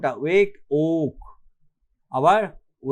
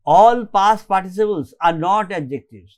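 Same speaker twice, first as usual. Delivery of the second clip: very slow, word by word, louder at the start; slow dictation; 2 semitones higher, 5 dB louder, much slower than usual. Shown as a demonstration. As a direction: dictate the rule slowly for notes. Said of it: "slow dictation".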